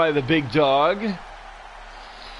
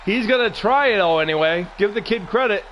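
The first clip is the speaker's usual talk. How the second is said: excited play-by-play; 6 semitones higher, faster than usual. Acted. Excited call of a move.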